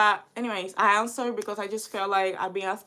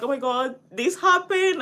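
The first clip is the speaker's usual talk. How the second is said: excited gushing; 5 semitones higher, 6 dB louder than usual. Retold or imitated. Gushing news to her bestie.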